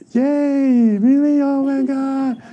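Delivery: high-pitched gushing cheer; hammed-up delight, words stretched out; mock excitement. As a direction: play an over-thrilled admirer, voice up high. Acting out cheering over miracles.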